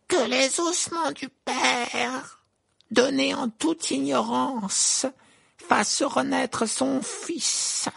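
high, slow, trembling spell; terrified incantation. Acted. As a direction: chant the spell in terror. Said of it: "Sa voix tremblait et il semblait fou de terreur".

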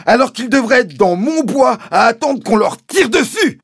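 words bursting out like lava; Mister Hazel erupting. A narrator's reading. Landowner raging about his stolen birds, erupting in high shouts. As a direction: rage at a high, loud screech. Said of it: "comme la lave brûlante d'un volcan".

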